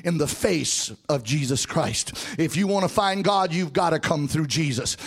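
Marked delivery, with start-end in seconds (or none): none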